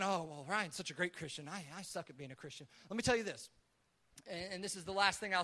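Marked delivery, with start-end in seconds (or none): 0.0-2.7 lower, softer mock-dejected mumble; self-deprecating thought. Listener comparing himself to the pastor, deflated and quiet. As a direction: voice a listener's deflated inner thought, trailing off softly. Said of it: "before you go thinking".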